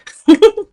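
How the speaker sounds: sudden high, loud laugh; burst of laughter. Real, not acted. Laughing at her own Minnesota accent, loud high laugh.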